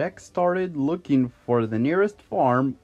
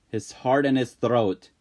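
ordinary delivery

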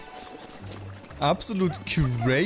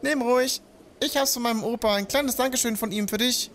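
put-on bright, high-pitched village kid; high villager voice; 10 semitones higher than usual. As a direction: play the excited village youngster. Voices the same villager NPC.

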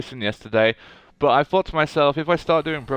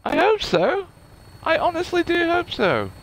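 very high-pitched playful reply; high squeaky voice. Pretend. Gamer answering a game character, in a high squeaky voice.

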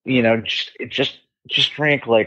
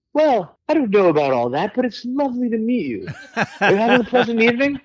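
high, gentle English voice, a little laugh in it; 12 semitones higher, faster than usual. Retold or imitated. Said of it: "he just said something like".

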